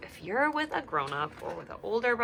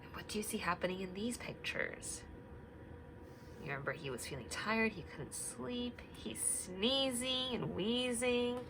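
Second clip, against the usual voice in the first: hushed storytelling; 3 semitones higher, 9 dB quieter than usual. Acted. Hushed reading about sick Bear.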